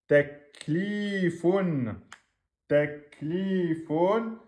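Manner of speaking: "Teklifun" with the li drawn out, slowly; two-beat long vowel. Shown as a demonstration. Teacher modelling the prolongation, long li. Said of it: "ici, on a un deux temps".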